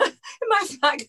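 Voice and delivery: slow, high, laughing through the words. Real, not acted. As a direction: still giggling with joy. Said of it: "I put the phone down and I went".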